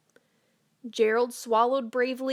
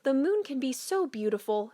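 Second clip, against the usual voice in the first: plain reading voice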